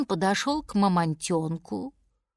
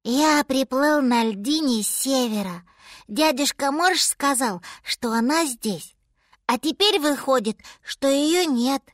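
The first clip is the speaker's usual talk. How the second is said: put-on higher, forlorn baby-animal voice; lost little mammoth; 4 semitones higher than usual. A narrator's reading. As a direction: sound small and lost. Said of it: "потерянно сказал мамонтёнок".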